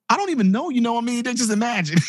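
high, bewildered banker voice; startled banker. Retold or imitated. Banker answers the kid's accusation in a high, bewildered voice.

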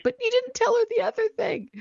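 very high squeaky pet voice; voicing the dog. Host voicing her dog Lola butting in, squeaky pet voice. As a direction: squeak it out like the little dog talking. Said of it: "Lola's like".

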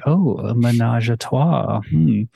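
low, drawn-out mock titillation; intrigued "oh", drawn out low. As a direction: savour it slowly, as if scandalously kinky. Quoting nineties titillation at kink.

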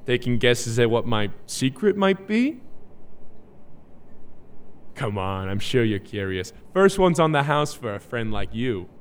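low, soft coaxing; put-on smooth talk. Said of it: "why are you talking like that?".

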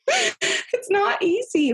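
real tears breaking through a laugh, slow and high; overwhelmed, half laughing, half crying. Real, not acted. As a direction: let the voice crack into a teary laugh. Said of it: "expect to cry like that".

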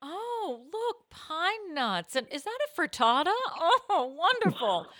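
high, delighted posh voice; posh squirrel voice. Pretend. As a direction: gush like a fancy guest at brunch.

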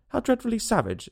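high, shocked exclamation; shocked exclaim. A narrator's reading. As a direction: gasp it out in horror. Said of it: "exclaimed Alice".

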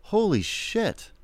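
high, drawn-out amazement; acted starstruck excitement; excited. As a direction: stretch it out high in thrilled awe. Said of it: "like, excited".

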